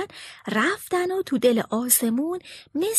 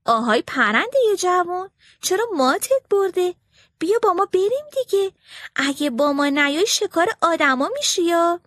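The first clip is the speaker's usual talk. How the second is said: high, quick bird voice calling a friend; starling urging the myna to flee; young starling; 6 semitones higher, 3 dB louder than usual. A narrator's reading. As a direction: chirp it out like an eager little bird. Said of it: "سار اولیه گفت".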